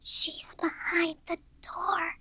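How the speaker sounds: put-on slow, high child's whisper; hushed and drawn out, barely voiced. A narrator's reading. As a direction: whisper a warning as a frightened little girl. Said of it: "she whispered suddenly".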